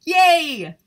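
loud, high cheer. Acted. The flowers cheer the next stop, loud and high. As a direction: whoop for joy.

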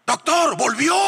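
high shouted call; panic, call the doctor over; startled nurse. Retold or imitated. Nurse calling the doctor as the dead man sits up, alarmed shout.

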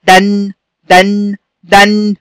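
drawn-out دن, short a into a hummed n; fatha tanween. Shown as a demonstration. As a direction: say دن slowly as "dan" with a long n. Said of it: "أهو هكذا". (نطق دن "دَنْ" ببطء)

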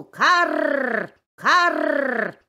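crow caw. Acted. Crow cawing after fox.

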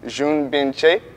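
usual speaking voice

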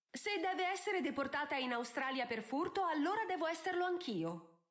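high-pitched, self-sacrificing melodrama; theatrical declaration. A narrator's reading. Daughter offering to be deported with Sophie, theatrically. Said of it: "esclamò Posy in tono teatrale".

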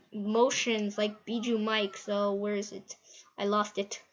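ordinary delivery

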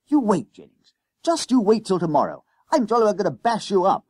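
high, loud, incensed schoolboy; incensed threat. A narrator's reading. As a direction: threaten him hotly, picking up speed. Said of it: "Temple was incensed".

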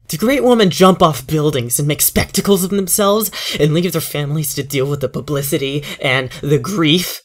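high loud furious tirade. Acted. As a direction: rage through it, building. Grieving father's bitter outburst.

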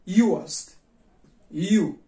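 "Ю" loud and drawn out; long u sound. Shown as a demonstration. Teacher models long u vowel for viewers. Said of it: "овози дарозаш".

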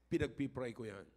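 ordinary delivery

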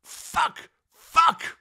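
very high, hammed-up yells; furious outburst. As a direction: explode in shouted swearing. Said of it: "he declared".

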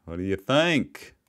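slow mimicked Australian accent; imitated Aussie accent. Echoing the actor in the clip, imitated Aussie accent. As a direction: echo it with a drawn-out Aussie twang. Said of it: "I heard a little Aussie there".

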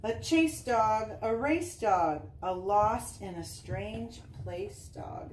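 bouncy sing-song picture-book reading; reading to children. Acted. Mom reading a dog book to Easton, sing-song read-aloud. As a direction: read the rhymes with a lilt for little kids.

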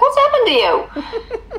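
high, slow, laughing disbelief; what happened to you, teasingly; mock dismay. Mock dismay at British diet.

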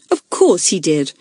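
ordinary delivery

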